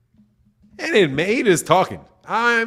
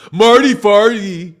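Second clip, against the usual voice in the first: mocking kid; 3 semitones higher, 6 dB louder, slower than usual. Mocking schoolkid fart joke.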